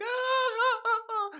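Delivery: high mock wailing sobs; mock crying. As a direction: wail like a hammed-up crying woman. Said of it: "she's all crying and shit".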